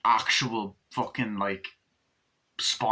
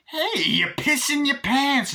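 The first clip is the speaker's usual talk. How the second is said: very high, slow, loud Chucky voice; Chucky's voice; 5 dB louder, slower than usual. Retold or imitated. Chucky mocking Glenn, very high and loud. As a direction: jeer at Glenn. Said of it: "Chucky's like".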